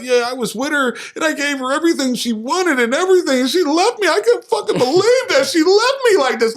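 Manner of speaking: high, whining mock lament; hammed-up whiny heartbroken guy; whiny soft man. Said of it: "you soft ass boy".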